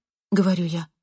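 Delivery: ordinary delivery, nothing marked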